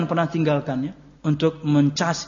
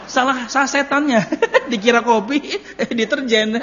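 high and loud, words half laughed; laughing; 6 semitones higher, 4 dB louder than usual. Real, not acted. Preacher laughing at his joke about a confused old man. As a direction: laugh through the punchline.

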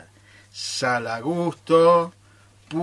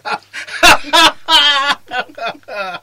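loud high burst of triumphant laughter; big laugh; 11 semitones higher, 8 dB louder than usual. Acted.

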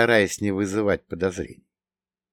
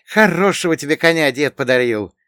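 high, louder voice with a touch of envy; envious sigh; 7 semitones higher, 7 dB louder than usual. A narrator's reading. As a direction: admire it enviously, pitched up. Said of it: "с завистью вздохнул Богдан".

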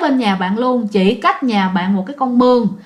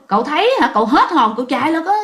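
normal talking voice

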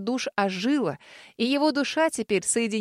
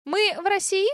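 tiny dragon voice; 10 semitones higher than usual. A narrator's reading.

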